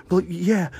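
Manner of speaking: high, loud exasperated answer; mock defensive woman. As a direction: imitate her conceding, put out. Diner answers in court.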